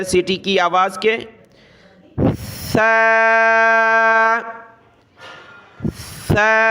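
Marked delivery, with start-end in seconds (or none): 2.7-6.7 سا with the tongue tip between the teeth, soft and without a hiss; سا drawn out softly, tongue between teeth. Shown as a demonstration. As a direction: say سا gently, tongue out past the teeth, no whistle. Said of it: "زبان کے نوک دانتوں سے باہر نکال کر نرمی کے ساتھ ادا کرنا ہے".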